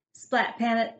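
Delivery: plain reading voice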